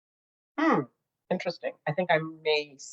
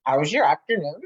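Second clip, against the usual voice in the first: louder, playful mock-British delivery; imitated British accent; 7 dB louder than usual. Crafter imitating her UK friend saying the colour, put-on British accent. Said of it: "her accent and saying it".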